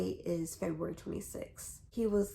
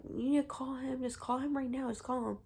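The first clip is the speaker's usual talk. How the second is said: mom's insistent nagging, slightly higher; retold urging; 5 semitones higher, faster than usual. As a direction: imitate mom pushing for the call. Retold mother urging a call.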